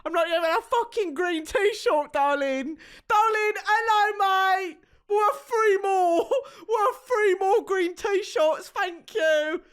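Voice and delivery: high, hammed-up British accent; mock British accent. Mocking a British guy at the bar, hammed-up British accent. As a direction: do a silly posh British accent. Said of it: "I have to mock British people".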